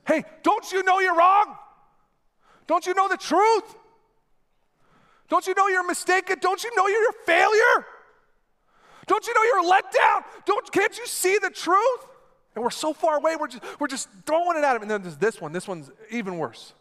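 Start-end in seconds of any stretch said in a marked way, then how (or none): 0.1-12.1 high, loud shouted accusations; harsh condemning yell. Shown as a demonstration. Preacher showing how we yell truth from a distance, harsh shout. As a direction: fling each question at him like a stone. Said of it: "This is how we do it from a distance".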